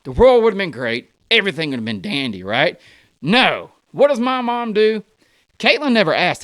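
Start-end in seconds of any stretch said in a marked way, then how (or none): none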